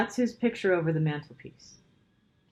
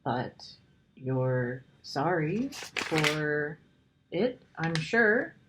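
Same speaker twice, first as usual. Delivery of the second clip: put-on slow, careful, gentle girl; rather slowly. A narrator's reading. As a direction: weigh each word so as not to hurt him. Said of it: "said Lucy rather slowly".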